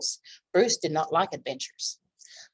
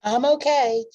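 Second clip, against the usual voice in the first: relieved call; 6 semitones higher, 7 dB louder than usual. Acted. Nibs after the rescue.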